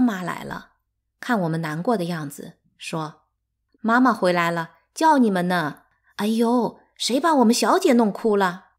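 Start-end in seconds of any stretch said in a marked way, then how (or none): 3.8-8.7 loud, bustling servant's call; loud, coddling maid; fussing maid. A narrator's reading. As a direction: exclaim with concern, voice rising high. Servant arrives, fusses over crying children.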